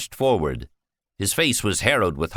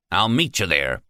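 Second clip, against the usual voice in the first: plain reading voice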